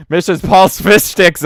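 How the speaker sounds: high, loud hammed-up critic; mock critic ad voice. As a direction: announce the fish sticks grandly. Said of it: "now you're just swapping critic".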